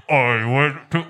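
imitating the husband's deep voice; mock deep voice. Host teasing a guest about his deep voice, mock deep voice. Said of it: "his is like".

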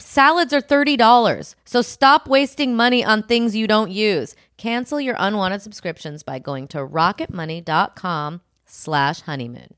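upbeat sponsor pitch read to listeners. Acted. Host pitching a finance app to listeners, bright ad read. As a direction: sell the app like a podcast host.

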